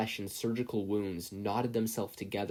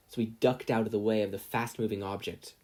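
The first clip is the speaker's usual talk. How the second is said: usual speaking voice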